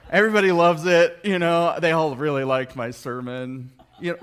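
loud, laughing, hammed-up boast; laughing mock brag. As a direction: crow about the sermon, laughing. Mocking boastful preacher.

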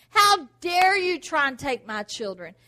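put-on loud with a crying edge on children; loud rebuke with a sob in it; tearful rebuke. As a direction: voice breaking as you defend your children. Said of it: "when the devil comes to attack you".

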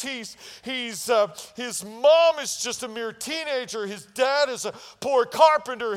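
loud, high sermon climax; preaching at full force. Real, not acted. Pastor proclaiming God's command to the church, full-force preaching. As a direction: drive it home to the congregation.

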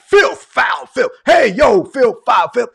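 loud, high mock shouting; imitating cursing. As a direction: shout it like a foul-mouthed street talker, exaggerated. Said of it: "when you talk, he was like".

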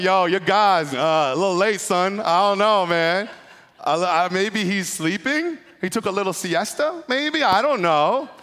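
loud, high, street trash talk. Retold or imitated. Elijah trash-talking the false prophets, loud and high. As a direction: talk smack like a pickup game. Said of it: "Starts saying".

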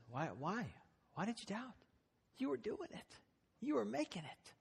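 high, soft bewildered why; gently amazed he gave up; pleading Jesus. Acted. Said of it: "And Jesus just says".

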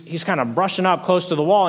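normal talking voice

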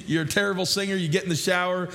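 usual speaking voice

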